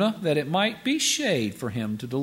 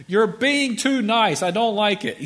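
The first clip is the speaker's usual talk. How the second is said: mock yelling; 5 semitones higher, 4 dB louder than usual. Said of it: "somebody yelling at someone".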